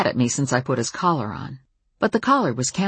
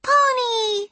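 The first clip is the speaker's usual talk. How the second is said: put-on very high, drawn-out child's squeal; gleeful preschooler voice; delighted little girl. A narrator's reading. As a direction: squeal at a pony as a tiny kid. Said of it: "she said, attacking him".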